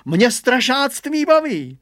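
high, loud, drawn-out brag; boasting. A narrator's reading. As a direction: show off with slow, crowing pride. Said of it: "naparoval se Hugo".

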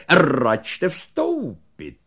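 very slow, drawn-out welcome; third invitation. Acted. Shopkeeper inviting them in a third time, slow and drawn out. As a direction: stretch each syllable invitingly. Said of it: "řekl do třetice".

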